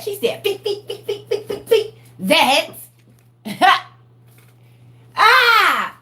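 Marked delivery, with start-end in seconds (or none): 0.4-2.7 high, giggly beep beep sword noises; imitated sword swishes. Daughter mimics swinging the sword at her brother, giggly sound effects. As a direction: laugh through playful sword sound effects.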